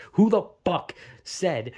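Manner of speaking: drawn-out, emphatic indignant question; exasperated outrage. Real, not acted. Gamer ranting at unknown game designers, exasperated. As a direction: demand an answer, hitting the words hard.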